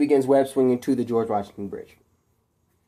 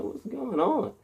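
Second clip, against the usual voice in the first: much higher confused question; puzzled; 9 semitones higher, 7 dB quieter, slower than usual. A narrator's reading. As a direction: sound startled. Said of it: "he's like".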